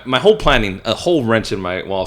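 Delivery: ordinary talk, nothing marked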